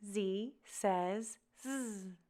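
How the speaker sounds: first Z named zee, last Z buzzed zzz; slow, soft, spaced Z says Z; name the first Z, buzz the last Z; letter and sound. Shown as a demonstration. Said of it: "practice saying Z says Z".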